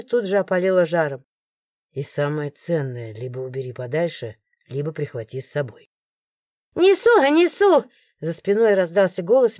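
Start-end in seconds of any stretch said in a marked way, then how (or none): 1.9-5.9 low, soft, confiding male voice; quiet guard. A narrator's reading. Morgan quietly continues. 6.8-7.8 put-on old lady calling. A narrator's reading. Old lady calls from kitchen.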